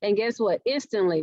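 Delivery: usual speaking voice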